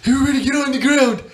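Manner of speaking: high, fast mock yell; urgent old teacher. Old gym teacher bursts into the gym, urgent mock yell. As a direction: burst in with a frantic high order. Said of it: "Bursts in through the door and goes".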